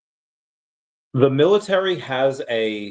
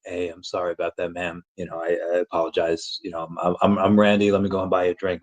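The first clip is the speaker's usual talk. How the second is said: a slightly lowered, smooth-talker apology; deep voice; 5 semitones lower than usual. Shown as a demonstration. Apologizing to a woman at a bar, lightly lowered deep voice. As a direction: drop the voice a little to charm her. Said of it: "You throw the deep voice".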